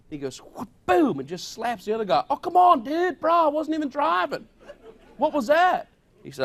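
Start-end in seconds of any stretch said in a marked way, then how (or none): 0.4-1.2 slap sound effect. Retold or imitated. Sheriff slaps second surfer. 2.3-5.9 high, loud, laughing protest like a wronged beach bum; outraged surfer dude. Retold or imitated. Slapped partner protests.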